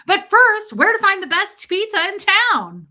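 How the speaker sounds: mock anchor pivoting to fluff; sing-song newscaster segue; chirpy news anchor. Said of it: "I'm like a news anchor".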